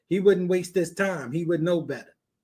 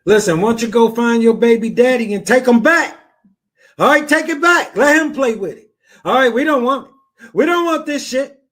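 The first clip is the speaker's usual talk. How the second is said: loud scolding; 4 semitones higher, 6 dB louder than usual. Real, not acted. Host yelling at caller to leave.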